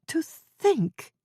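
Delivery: put-on high, slow, worried elderly woman; Ethel's fretful old voice. Old neighbor fretting to Jane over tea, high and slow. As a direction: quaver it like a flustered old neighbor.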